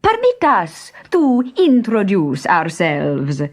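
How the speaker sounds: slow, courtly bandit voice; a lightly voiced gallant bandit; thin bandit, polite. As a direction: introduce yourself with ceremony. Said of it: "said the thin man to Martha".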